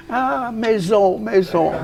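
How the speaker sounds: a hint of a sad little alien, slow and soft; lightly voiced E.T. longing for home. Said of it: "E T". (E.T. triste, doux et lent)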